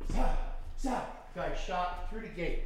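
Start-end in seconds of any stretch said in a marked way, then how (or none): 0.1-1.3 mock yell. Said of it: "Now I'm going".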